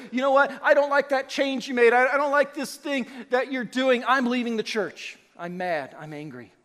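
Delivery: loud, annoyed church member complaining; a member threatening to leave, loudly annoyed; mock angry congregant. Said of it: "Sometimes people say".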